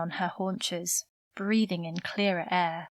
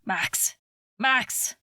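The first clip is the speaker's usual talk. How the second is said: urgent call, building higher; 3 semitones higher, 3 dB louder than usual. A narrator's reading. Father trapped by flames calling his son, urgently calling out. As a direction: call the name, then cry it higher. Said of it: "his father was calling out".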